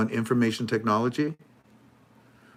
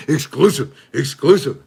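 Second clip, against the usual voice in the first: louder hyped announcer call; mock news hype; 4 semitones higher, 9 dB louder than usual. Mocking the news channel, a mock hyped announcer. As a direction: announce it like urgent breaking news.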